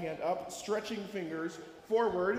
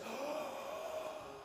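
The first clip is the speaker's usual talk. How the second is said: deep breath in; 4 semitones higher, 10 dB quieter than usual. Shown as a demonstration. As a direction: breathe in sharply. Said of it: "We go like this".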